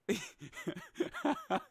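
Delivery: high, soft laughing; amused laughter. Real, not acted. Streamer having fun with his chat, giggling high. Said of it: "I am having fun with this".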